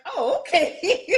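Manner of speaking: high, slow laughing okay. Retold or imitated. Retelling her cousin's answer, a high laughing okay. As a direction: laugh it off in dawning surprise. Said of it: "And I'm like".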